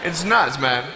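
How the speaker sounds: normal talking voice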